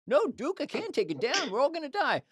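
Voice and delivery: high, panicky sailor voice; panicked sailor. Retold or imitated. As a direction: squeal it like a scared sailor. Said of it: "there's a movie where he goes".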